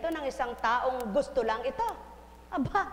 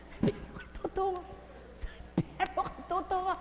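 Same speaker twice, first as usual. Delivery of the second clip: mock voice; 5 semitones higher, 4 dB quieter than usual. Shown as a demonstration. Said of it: "ilalakas natin ang konti".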